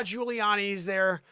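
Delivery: normal talking voice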